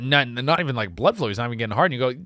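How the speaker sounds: usual speaking voice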